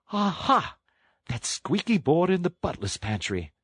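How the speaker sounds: high, excited toad voice; high-pitched delighted discovery; Toad catching on. A narrator's reading. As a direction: pipe up with sudden realisation.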